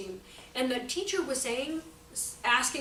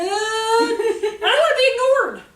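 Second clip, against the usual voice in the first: playful defiance; 9 semitones higher, 10 dB louder, slower than usual. Real, not acted. Defiant joking declaration.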